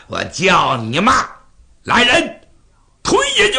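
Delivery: loud order climbing to a high shout; enraged king calling for the guards; furious command. Acted. King ordering cursing maids pushed into the pit, furious shouted command.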